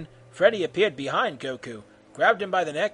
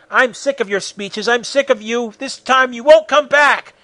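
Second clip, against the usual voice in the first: climbing higher and louder to a shout; angry outburst; 7 semitones higher, 8 dB louder than usual. Acted. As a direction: build in fury with each sentence. Enraged Goku snaps back at Freddy.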